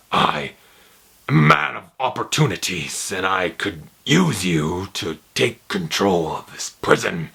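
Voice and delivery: slow, low, measured menacing Kingpin voice; Kingpin impression. Fan doing Kingpin offering Punisher a deal, slow low menace. Imitating Kingpin in prison scene.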